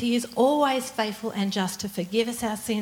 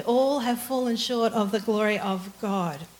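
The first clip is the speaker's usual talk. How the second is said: ordinary talk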